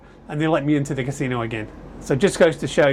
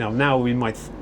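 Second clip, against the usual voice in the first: ordinary talk, nothing marked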